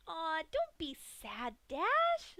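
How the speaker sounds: high, slow, sickly-sweet coo; cooing Pinkie. A narrator's reading. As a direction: comfort her like a toddler, creepily. Pinkie cooing to captive Dash.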